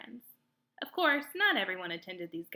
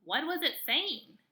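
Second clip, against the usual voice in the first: anxious groundhog; 7 semitones higher than usual. Acted. Groundhog asks.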